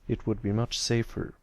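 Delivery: ordinary delivery, nothing marked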